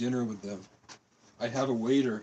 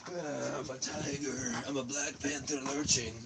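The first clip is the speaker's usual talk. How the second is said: usual speaking voice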